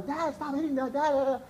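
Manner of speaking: imitating a child's high protest; distressed daughter. Daughter in the car as parents fight, mimicked high and pleading. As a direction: pitch it way up like a pleading kid. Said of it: "My daughter".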